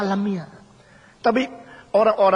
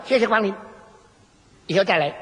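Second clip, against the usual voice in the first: same rote greeting tone. Retold or imitated. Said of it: "restoran sama nadanya".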